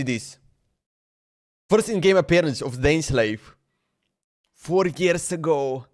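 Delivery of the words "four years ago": ordinary talk, nothing marked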